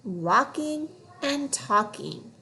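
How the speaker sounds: "walking and talking" read slowly and evenly; slow fluency read. Shown as a demonstration. Teacher reading a fluency phrase slowly.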